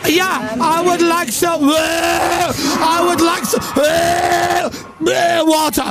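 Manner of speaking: high, slow, straining plea for water; mock sick contestant. Host mimicking the sick X Factor contestant, high strained plea. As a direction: play the poorly contestant begging.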